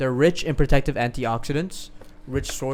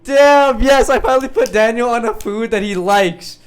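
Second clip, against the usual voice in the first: excited shout; 10 semitones higher, 13 dB louder than usual. Real, not acted. Thrilled friend loves the dates.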